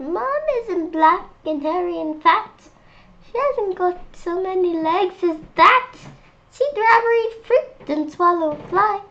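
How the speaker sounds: high monkey voice saying no; little monkey voice. Acted. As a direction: protest like a small stubborn monkey. Monkey and butterfly banter.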